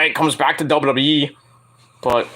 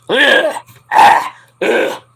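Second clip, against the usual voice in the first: disgusted groans; 8 semitones higher, 5 dB louder, much slower than usual. Real, not acted. Disgusted groans after rant.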